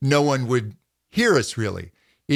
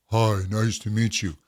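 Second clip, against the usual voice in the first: lower, dull greeting; 5 semitones lower than usual. Shown as a demonstration. Coach repeats the dull low greeting. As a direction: let it sag low. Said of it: "Really feel that difference".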